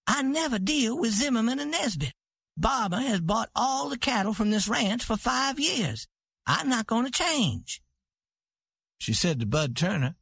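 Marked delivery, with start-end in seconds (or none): 0.1-7.8 higher woman's voice, proud and certain; proud ranch queen. A narrator's reading. Santa refusing her husband's buyers, proud and certain. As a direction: speak as the cattle queen who is never wrong.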